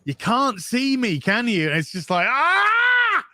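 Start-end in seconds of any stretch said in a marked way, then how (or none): 0.0-1.7 lightly voiced Doctor, higher; a hint of the Doctor's teasing line; quoting the Doctor. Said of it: "What did he say?". 2.3-3.2 sudden high yelp of delight; high excited fan squeal; overwhelmed cry. Real, not acted.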